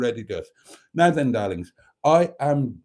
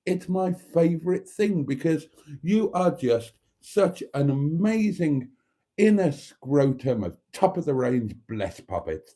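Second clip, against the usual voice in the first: campy drag queen. Acted. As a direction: gush over the audience theatrically. Camp show host greeting.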